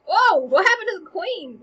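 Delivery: loud startled gasp of a question; alarmed. Acted. Osric seeing the queen collapse, loud alarmed surprise. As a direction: react as if you just saw her fall.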